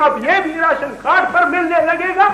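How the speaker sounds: high-pitched, sputtering indignation; indignant complaint. Acted. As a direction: rail at yet another shortage. Indignant at water having run out. (ऊँचे सुर में खीझ भरी शिकायत)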